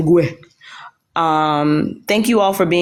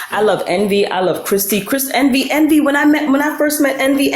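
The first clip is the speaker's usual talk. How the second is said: usual speaking voice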